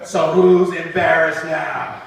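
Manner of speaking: slow, loud sing-song gloat; slow taunting gloat. Pretend. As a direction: gloat slowly.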